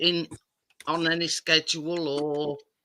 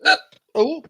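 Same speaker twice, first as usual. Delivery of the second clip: burping; 8 semitones higher, 6 dB louder than usual. Real, not acted. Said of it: "I'm burping now".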